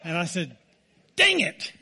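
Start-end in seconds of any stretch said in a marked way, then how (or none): 1.2-1.7 high, loud mock outburst; sudden high-pitched burst of frustration, playing up the retelling; retold exasperation. Husband retelling being stopped at red lights, exasperated outburst.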